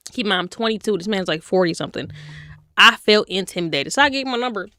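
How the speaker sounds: ordinary talk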